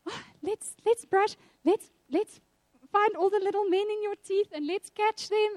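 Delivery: put-on high, coaxing sing-song for a toddler; playful mom voice. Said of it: "I would pretend to".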